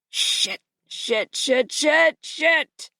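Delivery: whispered curse rising to a high panicked shout; building panic. A narrator's reading. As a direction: spiral from a breath into panic. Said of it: "his voice getting more panicked".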